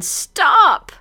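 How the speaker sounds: high, loud single-word cry. Acted. Heroine crying out to her captor, high and loud. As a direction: blurt it high as its own phrase. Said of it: "also cries out".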